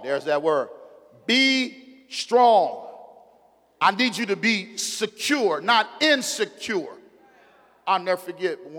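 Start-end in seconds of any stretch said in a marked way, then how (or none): 1.3-7.0 loud, high preacher's cry of God's words; booming pulpit proclamation; full-force preaching. Acted. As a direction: preach it out, rising and ringing. Preached command.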